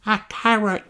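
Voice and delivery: put-on high child voice; little Rebecca Rabbit voice. Rebecca telling the teacher her idea, high child voice. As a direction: offer it shyly.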